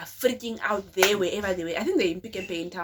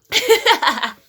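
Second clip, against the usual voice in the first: loud high burst of laughter; 13 semitones higher, 15 dB louder than usual. Real, not acted. To viewers about the fake number, loud laugh.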